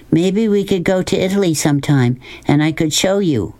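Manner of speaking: lightly voiced girl making an offer; Sistine, eager young character.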